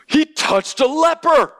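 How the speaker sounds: scandalised shock. Pretend. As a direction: play a shocked first-century onlooker. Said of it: "we would go".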